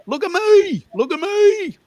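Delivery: very high, loud Melbourne squeal on "me"; hammed-up high-pitched Melbourne twang; Melbourne accent. Shown as a demonstration. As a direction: exaggerate Melbourne, stretching "me" way up high. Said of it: "the other one is me".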